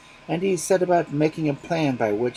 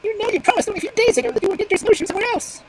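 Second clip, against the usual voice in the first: much higher, louder and fast, pleading; desperate reminder; 8 dB louder, faster than usual. Acted. Magpie reminding the fox of his promise, frantic. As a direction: rush it out in a panic.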